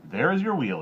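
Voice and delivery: a king giving a curt command; king ordering. A narrator's reading. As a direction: point her to the wheel. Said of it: "he told her".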